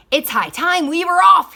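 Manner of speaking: high, loud captain's call; booming pirate announcement. A narrator's reading. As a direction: announce it to the whole ship. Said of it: "he announced".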